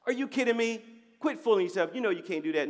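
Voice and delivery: mock-exasperated scolding; mock scornful God. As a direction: act out an exasperated put-down as God would never give. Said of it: "God don't speak like that".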